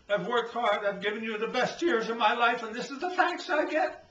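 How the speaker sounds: rising from hurt to a loud, high indignant cry; hurt husband building to outrage. Acted. Husband finds wife cheating.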